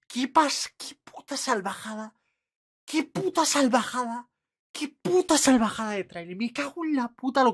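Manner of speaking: high-pitched, real disbelieving excitement; amazed outburst. Real, not acted. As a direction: pitch it way up, blown away by the trailer. Amazed outburst after watching the trailer. (exclamación aguda de puro asombro)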